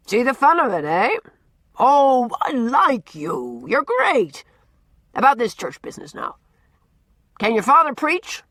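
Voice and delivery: put-on loud, gruff, teasing old man holding forth; blustering old Norman. A narrator's reading. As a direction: boom it out like a cranky, likeable bully. Norman delighted.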